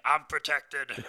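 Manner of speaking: slow, smug, muffled-sounding line; lightly voiced self-satisfied masked man; mock smug guy. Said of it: "He's like".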